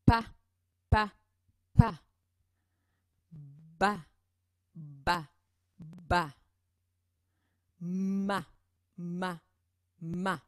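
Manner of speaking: "Pa, pa, pa", "Ba, ba, ba", "Ma, ma, ma" repeated as crisp separate syllables; teacher leading a repeat-after-me drill. Shown as a demonstration. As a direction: model a repetition drill for the class. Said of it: "Allez-y quelques fois".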